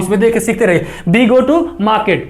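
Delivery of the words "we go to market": very slow, word by word, careful English; teacher slowly modelling an English sentence; slow model pronunciation. Shown as a demonstration. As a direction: spell it out slowly for students to copy. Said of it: "pronunciation करना भी बता रहा हूँ".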